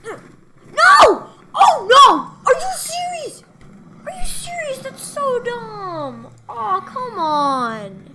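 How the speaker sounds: high, loud outraged wail; indignant shout, slowing on the last words; frustrated yelling. Real, not acted. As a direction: scream at the unfair game. Loses the round.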